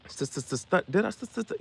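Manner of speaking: real stutter on the first sound; tripping over the word start. Real, not acted. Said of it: "yeah, I'm stuttering".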